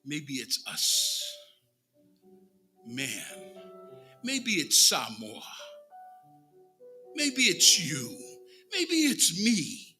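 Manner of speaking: ordinary talk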